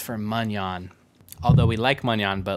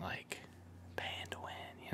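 whispering; 22 dB quieter than usual. Real, not acted. Aside whispered to chat.

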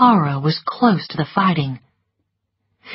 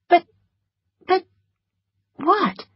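high, halting bewilderment; baffled, pitched up high; confused; 12 semitones higher than usual. A narrator's reading. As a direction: stammer in confusion with long gaps. Confused after refusal of marriage.

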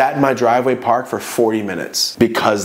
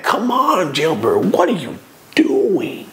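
exasperated plea; 6 semitones higher, slower than usual. Retold or imitated. Exasperated plea to his friend.

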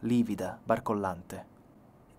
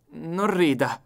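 sharp, higher, louder rebuke; annoyed; 6 semitones higher, 6 dB louder, much slower than usual. A narrator's reading. Nelly scolding the narrator, annoyed. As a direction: snap at him, irritated. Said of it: "disse lei infastidita".

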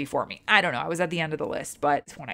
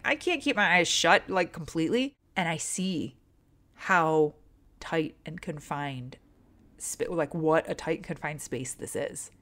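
ordinary talk, nothing marked

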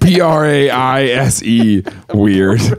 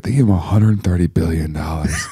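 low, softer croaky imitation; mock hoarse voice; 6 semitones lower, 4 dB quieter than usual. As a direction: mimic a scratchy low voice. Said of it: "the whole time you're just like".

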